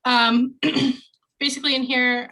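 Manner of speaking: quick, slightly nervous start; rushed nervous demo. Real, not acted. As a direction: hurry into it after a nervous laugh. Nervous laugh, rushing into demo.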